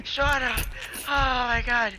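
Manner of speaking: high, breathless panicked cries. Real, not acted. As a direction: yelp as if just killed by the monster. Panic after dying.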